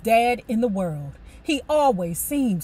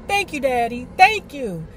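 slightly raised thanks; plain excited thanks; 3 semitones higher, 3 dB louder than usual. Retold or imitated. Girl thanking her father, mildly excited. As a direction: modest happy thank-you. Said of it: "I shrieked".

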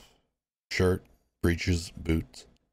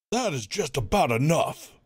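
a higher, stern warden voice cutting in; 8 semitones higher than usual. Acted. Warden warning the crowd, stern. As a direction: warn the crowd firmly.

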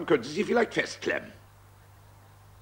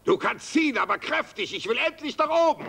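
ordinary talk, nothing marked

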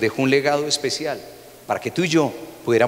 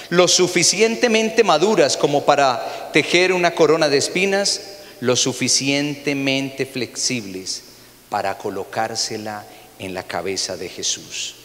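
ordinary talk

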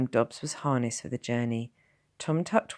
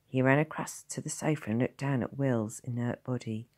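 ordinary delivery, nothing marked

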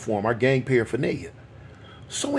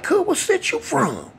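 gang challenge. Retold or imitated. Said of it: "I'm like".

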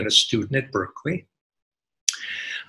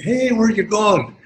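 friendly driver's call; 9 semitones higher, 5 dB louder, slower than usual. Retold or imitated. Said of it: "car drives up".

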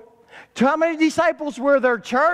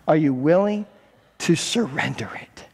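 whisper; 8 semitones lower than usual. Acted. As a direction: drop to an urgent whisper on surrender it. Jesus voiced, urgent repeat.

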